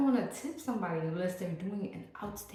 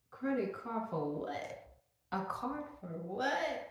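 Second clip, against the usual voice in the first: higher, slow, baffled question; incredulous; 5 semitones higher, slower than usual. Retold or imitated. Vlogger retelling her reaction to fiance, slow incredulous. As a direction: stretch it out in disbelief. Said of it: "And I'm like".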